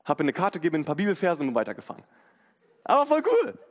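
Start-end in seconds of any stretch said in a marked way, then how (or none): 2.8-3.6 excited. Real, not acted. Excited about the encounter.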